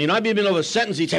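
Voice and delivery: usual speaking voice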